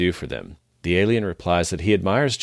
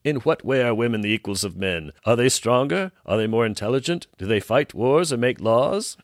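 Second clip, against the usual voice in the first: scornful alien ruler; 6 semitones higher than usual. Acted. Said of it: "causes an outburst of laughter from the ruler".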